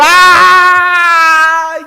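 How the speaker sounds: long, high acted cry; mock wail of pain. Preacher imitates José wailing through the palace, pained cry. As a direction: let out a drawn-out wail of grief. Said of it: "Se asustaron porque gritaba".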